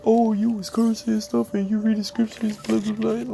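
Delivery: high, mocking critic voice. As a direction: imitate a preachy critic with a sneer. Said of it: "you can't go like".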